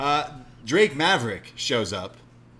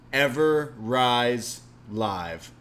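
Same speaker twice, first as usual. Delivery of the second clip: show name drawn out; 5 dB louder, much slower than usual. Acted.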